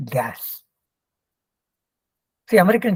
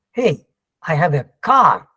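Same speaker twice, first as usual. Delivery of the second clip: "car" hit loud and drawn out; stress on CAR; 4 dB louder than usual. Shown as a demonstration. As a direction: build to a big, long "car". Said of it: "he's having a car and not a motorbike".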